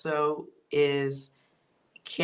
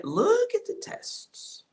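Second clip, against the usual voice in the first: high-pitched; slower than usual. Real, not acted. Sudden high exclaim.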